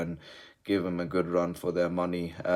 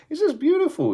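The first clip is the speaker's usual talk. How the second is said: a much higher, louder burst of delight; gleeful squeal; 5 dB louder than usual. Real, not acted. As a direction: squeak it out in pure joy. Joy at Chelsea high in table.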